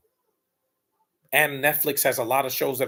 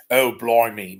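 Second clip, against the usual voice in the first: put-on British accent, louder; mock British accent; 6 dB louder, slower than usual. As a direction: mimic a punk with a British accent. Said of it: "a British accent".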